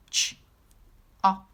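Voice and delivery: Ch- op said as separate sounds; sounding out. Shown as a demonstration. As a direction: sound out Ch- op with a pause between. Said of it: "let's read it together".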